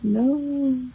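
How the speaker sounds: drawn-out, lightly wailed no; mock crying. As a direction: imitate a tearful movie-watcher, stretching the word. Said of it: "I was actually crying".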